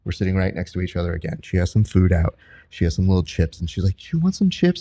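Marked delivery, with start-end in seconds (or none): 4.1-4.8 high, gentle imitated offer; sweet woman. Woman offering her snack to a seat neighbour, high and sweet. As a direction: voice a kindly lady, pitched well up. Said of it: "this very sweet Mexican woman".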